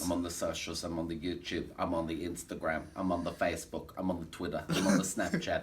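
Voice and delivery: low, soft, monotone roll-call; deadpan listing. Pretend. Showman listing his socials to host, deadpan. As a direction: reel off each item in the same low drone.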